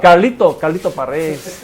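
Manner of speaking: "Carlito Paredes" said the Spanish way, with a tapped r; "Carlito" called out loud in a Spanish accent, then "Paredes" rolled Spanish-style; the name "Carlito Paredes" said as a Spanish speaker would; Spanish pronunciation. Shown as a demonstration. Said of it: "That's why you say Charlie's name so well".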